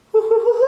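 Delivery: high, loud hooting owl call; answering owl hoot. A narrator's reading. The owl answering Pa from the trees with a loud, high hoot. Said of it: "an echo came threading its way".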